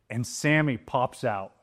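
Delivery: usual speaking voice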